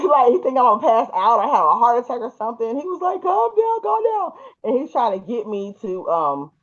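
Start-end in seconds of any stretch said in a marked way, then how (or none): 3.2-4.3 high, anxious imitation of husband; mock panic, pitched way up; panicked soothing. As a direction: urge someone frantically to settle. Retold husband urging her to calm.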